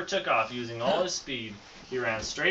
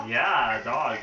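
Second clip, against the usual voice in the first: usual speaking voice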